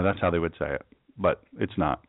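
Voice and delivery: normal talking voice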